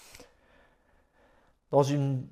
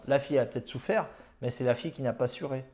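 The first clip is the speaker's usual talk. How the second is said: ordinary delivery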